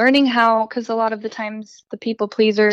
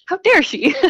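how dare she pitched high and louder, dare hit hard; playfully scandalized, punching dare; high mock outrage; 9 semitones higher, 5 dB louder than usual. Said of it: "I was like".

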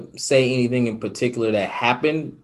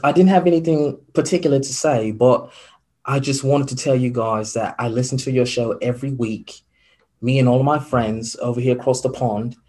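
a London listener's polite call-in, in a put-on British accent; 2 semitones higher, 6 dB louder than usual. Podcast host imitating a London caller praising the show, British accent. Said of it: "I have a horrible accent".

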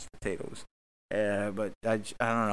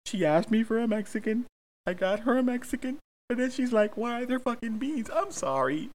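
much higher, far whiny rant; whiny little voice; 11 semitones higher than usual. Acted. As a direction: squeak it out like a wronged kid. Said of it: "I sound like a little bitch".

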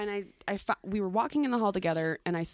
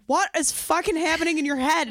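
exasperated friend; 8 semitones higher, 5 dB louder than usual. Retold or imitated. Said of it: "she'll look at me like".

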